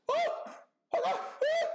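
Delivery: very high, loud re-enacted screams; panicked shriek. Spider drops near his face, acted panicked screaming. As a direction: shriek and yelp as if dodging a spider.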